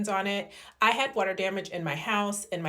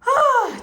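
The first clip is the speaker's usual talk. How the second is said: high happy squeal; excited squeal; 7 dB louder than usual. Real, not acted. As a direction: squeal with delight. Excited about new bed.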